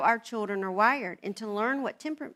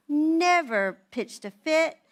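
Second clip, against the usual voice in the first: normal talking voice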